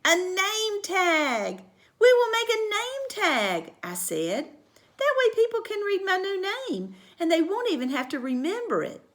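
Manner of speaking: put-on girl proposing a name tag; eager idea. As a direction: pitch it proudly. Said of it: "girl proposes idea".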